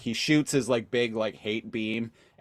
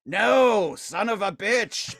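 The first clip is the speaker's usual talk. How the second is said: high, slowed cartoon villain curse; mock furious curse; 9 semitones higher, slower than usual. Galvatron cursing as he is pulled back, high and slow. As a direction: drag out the curse in rage. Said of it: "Galvatron is like".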